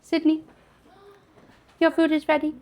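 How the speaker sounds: high, loud call to another room; yelling across the room. Real, not acted. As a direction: call her to come eat. Calling Sydney to come eat.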